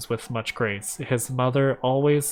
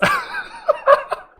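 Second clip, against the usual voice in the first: high-pitched laughing fit; 4 dB louder than usual. Real, not acted. Laughs at the story.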